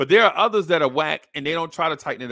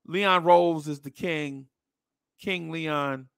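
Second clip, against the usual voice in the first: ordinary talk, nothing marked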